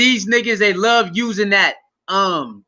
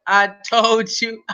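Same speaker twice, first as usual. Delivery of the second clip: ordinary delivery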